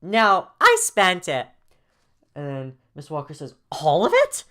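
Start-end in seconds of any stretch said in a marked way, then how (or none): 0.0-1.5 high, slow, loud admission; very loud kid. Acted. Jaden admitting to Miss Walker, very loud. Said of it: "Jaden's voice is very loud". 3.7-4.4 high, loud, rising question; shocked. Acted. Miss Walker hearing the money is gone, shocked.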